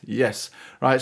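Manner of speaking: ordinary talk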